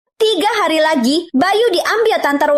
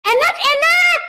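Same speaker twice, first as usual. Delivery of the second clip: high, excited cry of delight; delighted exclamation; 12 semitones higher than usual. Acted. Children tasting the food, high and delighted. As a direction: squeal it out with relish.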